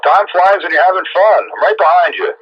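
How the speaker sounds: usual speaking voice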